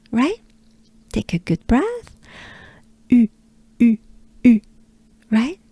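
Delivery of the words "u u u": U with lips rounded, nearly closed; short separate French U sounds; droopy French U. Shown as a demonstration. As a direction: drill U as "ee" through rounded lips. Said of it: "not fully closed, but close".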